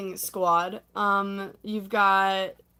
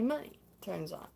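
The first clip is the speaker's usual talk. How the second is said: ordinary talk, nothing marked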